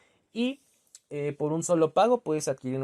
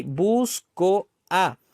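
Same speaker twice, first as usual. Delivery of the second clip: "busco a" very slow and higher, split into bus, co, a; syllable by syllable; 4 semitones higher, 9 dB louder, much slower than usual. Shown as a demonstration. Teacher showing students two vowels that meet, syllable by syllable. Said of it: "Se juntan y forman".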